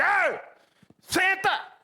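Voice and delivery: sudden loud, high-pitched yell; parent's sharp shouted command; shouting at child. Acted. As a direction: yell the order across a restaurant. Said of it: "eu não posso gritar no restaurante".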